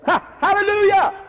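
high, loud hallelujah shout. Real, not acted. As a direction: burst out with a praising shout. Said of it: "I just shouted".

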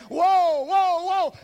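loud, high wail of woe; crying woe. Acted. Prophet crying out his lament, loud and high. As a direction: cry it out like a doomed prophet.

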